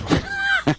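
short, high chuckle; quick amused laugh. Real, not acted. Host laughing at the girlfriend joke, high.

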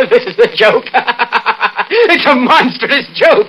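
high, broken laughing with a slowed last line; hysterical laughter. Acted. Danny realising his wife tricked him, hysterical laughing. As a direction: laugh like a man coming apart. Blackmailed husband breaks down laughing.

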